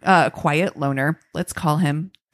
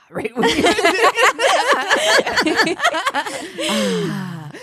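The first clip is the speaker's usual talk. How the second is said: giggling through the words after a sneeze; breaking into high laughter mid-sentence; laughing; slower than usual. Real, not acted. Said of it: "Sorry, I just sneezed".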